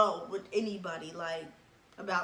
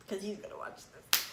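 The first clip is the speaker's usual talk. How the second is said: whispered aside; 5 dB quieter, faster than usual. Real, not acted. Aside that he'll watch.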